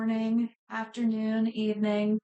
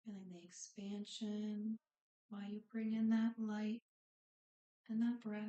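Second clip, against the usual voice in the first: unhurried breathing cues, phrases spaced far apart, softening as it goes; guided meditation. Real, not acted. Yoga instructor settles the class into breathing and body scan, guided meditation.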